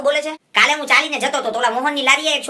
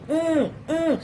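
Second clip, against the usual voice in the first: higher, soft whiny pouting hum; mock pout sound; 4 semitones higher, 5 dB quieter, much slower than usual. Retelling the girl's photo pose with a mocking pout hum. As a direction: imitate the girl's pout again. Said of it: "ક્યારની જામી હતી".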